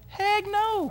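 high-pitched comic protest; emphatic denial. Real, not acted. Preacher denying he sent the kid to heaven, high comic protest. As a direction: squeak out an indignant denial. Answers own rhetorical question comically.

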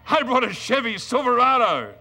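husband boasting to his wife about a Silverado; proud boast. Retold or imitated. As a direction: show off the truck with glee.

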